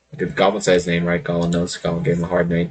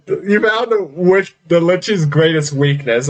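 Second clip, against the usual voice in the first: mock lich voice; 8 semitones higher than usual. GM voicing the lich.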